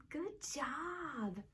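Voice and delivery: soft whispered good job; whispered praise. Acted. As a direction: breathe the praise softly.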